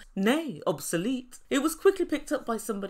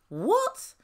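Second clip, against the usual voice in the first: incredulous; 8 semitones higher than usual. Real, not acted. Reacting in disbelief.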